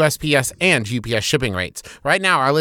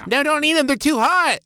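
mom voice; 11 semitones higher than usual. Pretend. Mom warning about hot biscuits.

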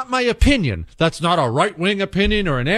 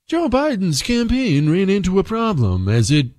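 news reporter voice. Retold or imitated. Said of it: "in that news reporter voice".

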